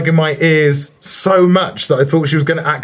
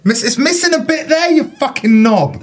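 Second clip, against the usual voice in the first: outraged at a friend; exasperated outburst; 10 semitones higher than usual. Real, not acted. Mates bickering over an ear, exasperated high outburst.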